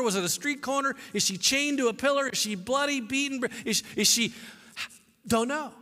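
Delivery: usual speaking voice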